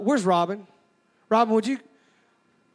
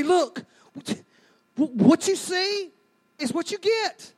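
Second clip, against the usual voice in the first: high, pleading excuse voice; pleading excuse-maker; 5 semitones higher than usual. Retold or imitated. Reluctant congregant pleading with Ronnie, high whiny excuse. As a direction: whine your way out of baptism.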